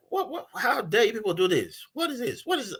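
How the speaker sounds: high-pitched mock yell without the volume; retold yelling. Outsider scolds the villagers, imitated indignant yelling. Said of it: "and I'm yelling".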